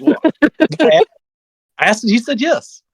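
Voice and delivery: high voice breaking into a laugh; amused retelling. Retold or imitated. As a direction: laugh through a simple explanation. His sheepish answer, with laughter.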